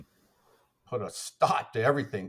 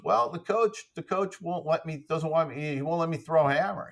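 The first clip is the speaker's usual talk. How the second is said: put-on higher-pitched retelling of a kid's excuse; lightly voiced athlete kid; 6 semitones higher than usual. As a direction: lightly imitate a kid explaining himself, pitched up. Coach voicing a kid's excuse.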